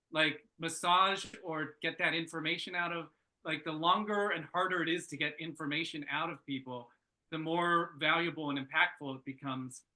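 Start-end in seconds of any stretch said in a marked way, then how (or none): none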